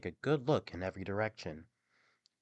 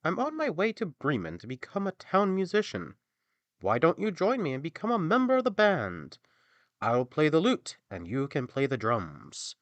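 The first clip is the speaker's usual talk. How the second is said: higher, louder cheerful donkey; donkey inviting the dog to join the band; 7 semitones higher, 7 dB louder than usual. Acted. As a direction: pitch the plan brightly as the donkey. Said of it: "the donkey said".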